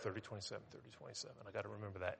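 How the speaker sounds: very soft muttered repetition; under his breath. Retold or imitated. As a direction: repeat the number barely audible to yourself. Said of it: "that under my breath".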